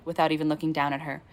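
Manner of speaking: ordinary delivery, nothing marked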